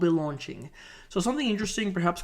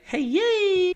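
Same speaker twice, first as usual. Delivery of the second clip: squeaky cheer; 13 semitones higher, 5 dB louder than usual. Acted. Mock cheer in video.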